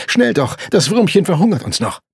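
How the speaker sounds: high, loud, urgent call; urgent hurrying. A narrator's reading. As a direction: hurry the girl along. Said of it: "mit hektischen Gesten, sich zu sputen".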